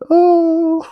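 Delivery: squeaky high startled oh; a tiny panicked yelp of surprise; caught-out oh. Retold or imitated. Caught lying about East London, squeaky startled oh. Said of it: "And I went".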